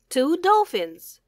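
usual speaking voice

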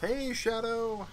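high, slow sing-song call to a pet; pet greeting voice. Acted. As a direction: coo at the pet like a baby. Greeting pet creature in game.

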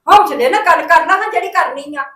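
loud, heated shouting at the family; furious family row shout; angry shout. Acted. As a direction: shout it out in anger. Family quarrel, raised voices.

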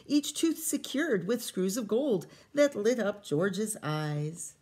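lively read-aloud storytelling voice; story reading. A narrator's reading. Teacher reading a picture book to kids, lively storytelling. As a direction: read the rhyming story to the children.

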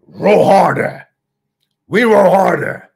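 loud, slow, drawn-out command to the crew; rallying shout. Acted. A captain urging his crew through the storm, loud rallying shout.

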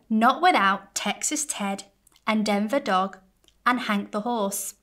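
ordinary delivery

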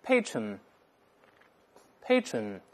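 patron read very slowly and clearly; drawn out for the class to repeat; model reading. Shown as a demonstration. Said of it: "我们大声来一遍".

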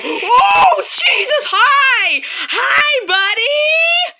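high, loud screams of fright; startled screaming. Real, not acted. Gamer startled by the monster, screaming. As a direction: shriek greeting at the jump scare. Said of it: "for that screeching hollering".

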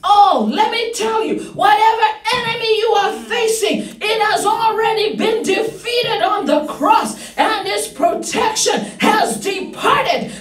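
excited, emphatic proclamation; fervent preaching. Real, not acted. Preacher assuring congregation of victory, fervent proclamation. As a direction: declare the enemy already beaten.

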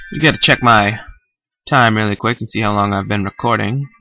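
ordinary talk

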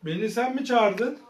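soft, slow officer's questioning; pretend police officer. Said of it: "Polisleri arayıp geliyorum".

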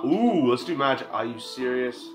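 usual speaking voice

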